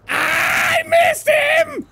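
very high slow whining wail; wailing after missing the shot; frustrated shriek. Real, not acted. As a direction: stretch it out in despair. Shrill frustrated outburst.

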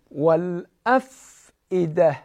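والافئده slowly with a still ف; careful recital. Shown as a demonstration. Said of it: "باید دقت کنیم". (تلاوت دقیق والافئده)

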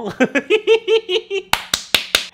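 long high-pitched laugh; laughter. Real, not acted. Host finishing his joking sponsor plug, high laughter.

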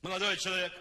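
loud call from behind; doorman calling out. Retold or imitated. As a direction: call out after a guest. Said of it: "Вдруг сзади крик".

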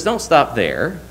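ordinary talk, nothing marked

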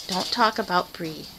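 ordinary delivery

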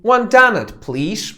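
One donut, please, bright and high; loud English order; English phrase demo. Shown as a demonstration. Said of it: "Możesz powiedzieć po prostu".

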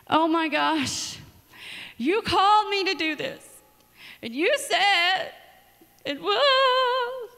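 high, loud, slow wail ending in a sung note; wailing complaint. Retold or imitated. Venting to God who called her, a loud high wail. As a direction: wail your grievance at God, half singing.

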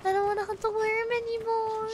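high, whiny complaint; high whine. Pretend. Whining about the quest.

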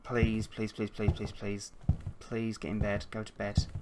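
soft desperate pleading; hushed pleading. Real, not acted. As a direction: beg under your breath. Begging character to reach bed.

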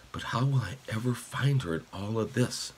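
softly voiced, doubtful question; wondering to himself. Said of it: "Hideki wondered".

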